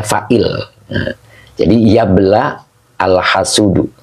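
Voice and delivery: normal talking voice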